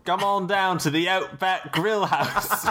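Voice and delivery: high, slow Australian ad-slogan voice; imitated Australian accent. Shown as a demonstration. Host plugging an Outback restaurant in the accent game, hammy Australian accent. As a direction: pitch it up and stretch it like an Australian restaurant advert. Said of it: "Imitate an accent".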